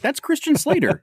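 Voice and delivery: high-pitched mock amazement; astonished recognition. Podcaster acting out a viewer spotting a star, astonished. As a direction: blurt it out like spotting a big star.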